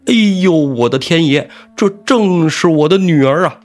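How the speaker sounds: loud, high shocked wail; anguished outcry. A narrator's reading. The old squire sees his daughter's head and cries out, high and loud. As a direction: cry out high and loud in horror.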